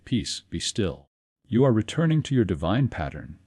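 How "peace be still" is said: speaking kindly. Shown as a demonstration. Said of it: "Speak kindly to it".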